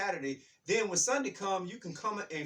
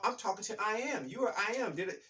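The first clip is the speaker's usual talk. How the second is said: ordinary talk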